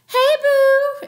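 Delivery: high, sing-song flirty greeting; high flirty hey boo; Cleopatra flirting. Retold or imitated. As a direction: coo it up high.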